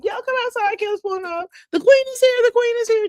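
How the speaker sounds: high, loud excited call out the door; calling everybody out. Retold or imitated. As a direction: announce the queen's arrival to the whole house. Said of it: "So they call everybody".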